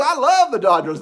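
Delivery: high, loud imitated outburst; gushing enthusiasm. Young man telling a stranger about his team, gushing enthusiasm. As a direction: voice a fan bursting with love.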